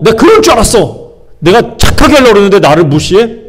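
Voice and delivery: hurt, half-acted outrage at being ignored; resentful anger. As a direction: play the slighted believer fuming. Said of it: "막 그래서 뭐 화가 나는 거예요".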